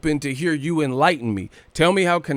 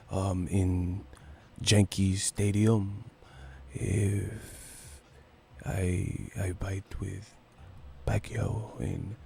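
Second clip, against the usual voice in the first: near-whisper, much softer and lower; Cotto whispering impression; 5 semitones lower, 12 dB quieter, much slower than usual. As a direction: mumble it softly like a soft-spoken fighter at the mic. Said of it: "That motherfucker be whispering".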